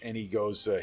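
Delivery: normal talking voice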